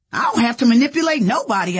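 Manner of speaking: fast, loud, high indignation; righteous. A narrator's reading. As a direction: protest hotly on principle. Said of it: "I was all righteous".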